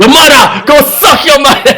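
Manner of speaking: loud high Jamaican-tinged yell; mock shouted insult. Comic trading insults with friend, loud mock yell. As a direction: bellow the joke insult.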